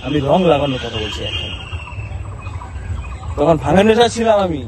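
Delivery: normal talking voice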